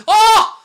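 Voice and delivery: sudden high, loud yelp; shouted "oh". Real, not acted. Fan watching a bicycle kick, high shouted "oh". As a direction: burst out at a near miss. Near miss at goal.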